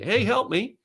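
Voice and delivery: high, soft pleading beggar voice; a hushed, lightly voiced plea from the corner. Hand up for the camera, mock beggar's soft high plea. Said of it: "with your hand out like the guy in the corner".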